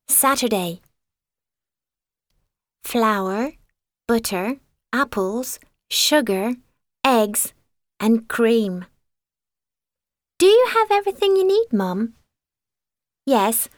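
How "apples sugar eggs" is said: ordinary delivery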